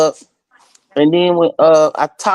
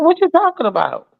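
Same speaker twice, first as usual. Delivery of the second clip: high, disbelieving question; incredulous; 11 semitones higher than usual. Retold or imitated. Caller hearing news of friend's death, shocked disbelief. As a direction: pitch it way up in disbelief.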